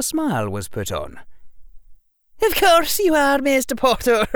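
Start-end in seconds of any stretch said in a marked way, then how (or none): 2.4-4.3 high, dry knowing tone; stern witch playing along. McGonagall sly reply.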